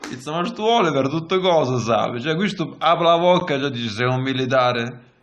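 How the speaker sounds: normal talking voice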